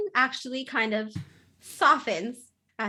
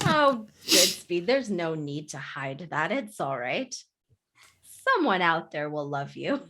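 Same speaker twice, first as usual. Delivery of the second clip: gentle consoling; 6 semitones lower, 4 dB quieter than usual. Acted. GM consoling Goodspeed as Santa.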